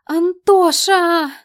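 long high drawn-out shriek; screaming. A narrator's reading. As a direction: wail the name at full volume. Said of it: "продолжала вопить ненормальная девица".

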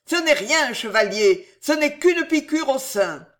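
high, loud wild joy; joyful roar. A narrator's reading. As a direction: shout the relief from afar. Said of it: "dans une clameur de joie folle". (clameur de joie folle)

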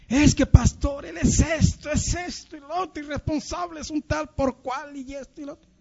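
imitating a whiny, high-pitched complainer; tearful complaining. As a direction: reel off grievances in a high plaintive voice, softening as you go. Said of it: "a quejarse".

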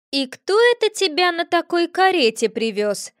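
high, put-on voice of a nosy cat; nosy cat asking who brought her home; curious cat voice. A narrator's reading. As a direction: play the curious familiar asking. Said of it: "поинтересовался Виля".

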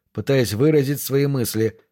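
usual speaking voice

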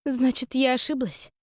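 upset. A narrator's reading. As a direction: voice a dismayed young woman doubting herself. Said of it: "расстроилась Аста".

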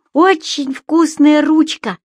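high, slow creature voice; Субастик. A narrator's reading. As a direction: savour it, high and drawn out. (высокий голосок Субастика)

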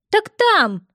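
high, loud granny exclaiming; old woman voice. A narrator's reading. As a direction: point and shout like a granny.

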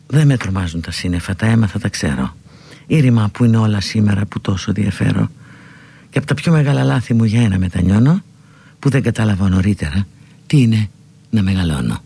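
χαμηλή, ήρεμη απαγγελία με παύσεις; διάβασε χαμηλά σαν ποίημα; απαγγελία στίχων. Acted. Απαγγέλλει πριν από το τραγούδι. (χαμηλόφωνη ποιητική εισαγωγή τραγουδιού)

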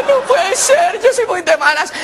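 high mock wail; hammed-up lament. Unlucky man after a failed attempt, wailing comically. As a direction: whine at your rotten luck theatrically.

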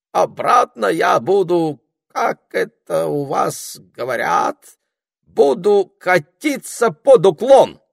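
high, loud, slow sing-song lady; cartoon princess. A narrator's reading. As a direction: coo it like a cartoon heroine. Engine lady says goodbye.

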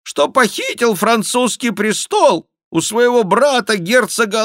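high, loud nasal jester; jester's nasal mock confession; nasal whine. A narrator's reading. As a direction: whine it loudly through the nose. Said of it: "загнусавил шут".

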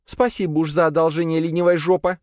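high, sarcastic snappish reply; sharp retort. A narrator's reading. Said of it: "резко ответила Лея".

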